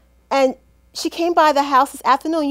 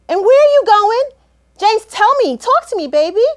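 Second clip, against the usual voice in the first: calling after him; 7 semitones higher, 7 dB louder than usual. Acted. Calling after husband walking out.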